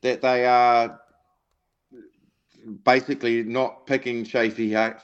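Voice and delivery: usual speaking voice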